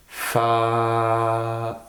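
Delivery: "Fa" with a long sustained a; vowel held long. Shown as a demonstration. Teacher sounding out fabriquer, "Fa" with lengthened vowel. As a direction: stretch out the a of "Fa". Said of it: "c'est le A".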